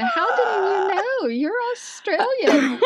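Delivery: high, laughing, half-crying protest; laughing exasperation. Retold or imitated. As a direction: laugh it out at the husband, high and pleading. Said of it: "And I'm like".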